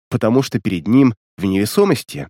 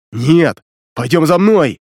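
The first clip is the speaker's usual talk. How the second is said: loud command; 4 semitones higher, 4 dB louder, slower than usual. A narrator's reading. Leader urges retreat.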